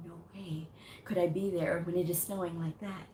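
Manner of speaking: quietly appalled aside; hushed disbelief. Retold or imitated. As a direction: say it under your breath, as if to yourself. Retells her reaction to huge snow.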